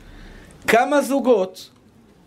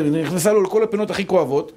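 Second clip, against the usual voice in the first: ordinary delivery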